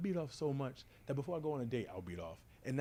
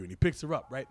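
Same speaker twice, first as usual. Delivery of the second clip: normal talking voice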